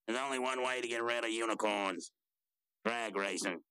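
put-on character voice, held on a level tone; Skips' voice. Skips advising how to beat unicorns, put-on even-toned voice. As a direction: play him on a steady, even note. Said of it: "he says".

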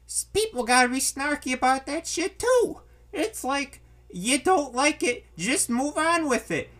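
high-pitched exasperated rant; fed up, voice pushed up high; pissed off. Real, not acted. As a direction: vent in real annoyance, pitch climbing. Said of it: "another thing that pisses me off".

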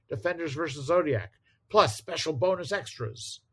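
hyped-up announcer read; comic announcer. Acted. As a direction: sell it like a comic blurb. Reading next-issue blurb aloud.

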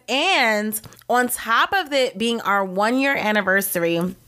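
ordinary talk, nothing marked

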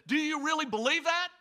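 high, loud question; acted-out daredevil calling down to the crowd. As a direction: call back to a shouting fan. Acted challenge to the yelling fan.

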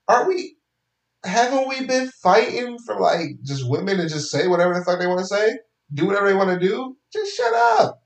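high-pitched exasperated rant. Real, not acted. Vlogger ranting at wap critics, high exasperation. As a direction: vent at the critics.

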